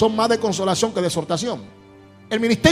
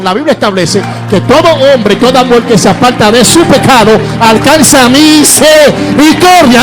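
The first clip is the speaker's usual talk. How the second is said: loud, high fiery preaching; preaching at full force; 6 semitones higher, 12 dB louder than usual. Acted.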